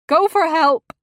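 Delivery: high, loud cry for help; panicked wife shouting for help; frantic shout. A narrator's reading. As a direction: scream for help.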